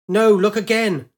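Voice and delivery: loud, high angry demand; frustrated shout. Acted. Pilot yelling at her computer to look again. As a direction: refuse the answer, yell.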